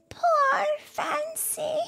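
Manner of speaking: put-on high, slow, tearful girl's voice; a young girl grieving for her pet; drawn-out high girl's cry; crying little girl. A narrator's reading. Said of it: "she cried".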